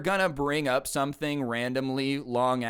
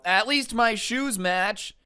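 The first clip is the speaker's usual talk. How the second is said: higher, drawn-out petulant retort; random blurted comeback; 8 semitones higher, slower than usual. Acted. Student breaks ten minutes of stewing, petulant blurt. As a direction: toss out a slow, high-pitched non sequitur. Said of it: "he just randomly blurts out".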